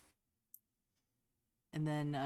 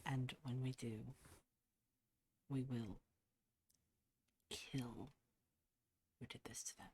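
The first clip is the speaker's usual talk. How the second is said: steady promise that sinks softer, down to a whisper at kill; 6 dB quieter than usual. Acted. Clement holding Tagok's face, vowing revenge, dropping to a whisper. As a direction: lean in and promise the killing quietly.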